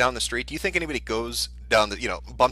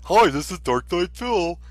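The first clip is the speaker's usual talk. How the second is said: cheesy ringtone greeting, slow and higher; Darkside Phil; 6 semitones higher, 4 dB louder, slower than usual. Acted. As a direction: put on a goofy, drawn-out promo voice. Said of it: "he's selling custom ringtones".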